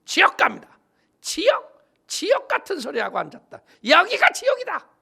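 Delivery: high, fast, scornful retort; bitter Hamgyeong woman. Retold or imitated. Angry woman rebuffing the evangelist, scornful high retort. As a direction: spit the words back in scorn. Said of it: "이 한경도 아주머니가 그러더래".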